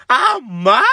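put-on a kid's wounded yelp of "¡Ah, ma!"; mock anguished cry. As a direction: cry out at mom as if betrayed. Crying out at her mother.

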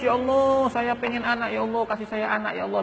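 high, wailing imitation of a prayer for a child; mock tearful begging. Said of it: "kalau kita nangis-nangis". (pura-pura meratap memohon anak)

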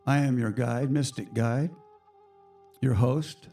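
ordinary delivery

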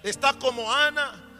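loud, rising preacher's cry, voicing Hannah's prayer; preaching at full force. Preacher voicing Ana's grateful prayer to the priest, impassioned preaching.

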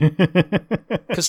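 very slow drawn-out words; slow it right down; slow talk. Shown as a demonstration. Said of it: "people talk slow".

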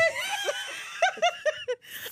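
high-pitched shriek of laughter. Real, not acted. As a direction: burst into a screaming laugh. Shocked at G D.

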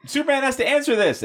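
high, fast squeaky panic; high excited voice. Retold or imitated. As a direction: blurt it out high and quick. Jimmy quoted, high excited.

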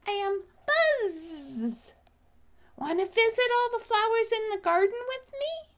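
a happy bee making a friend; cheerful little bee. Acted. Bee character speaking.

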